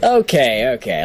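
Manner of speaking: real giggles breaking into the words; talking through laughter. Real, not acted. Host excusing his impression to listeners, laughing as he talks. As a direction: explain yourself while still laughing.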